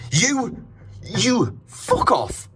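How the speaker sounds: high-pitched angry outburst; getting angry. Real, not acted. Sleepless guest cursing the noisy driver, getting angry. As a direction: swear up high in real annoyance. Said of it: "I'm getting angry now".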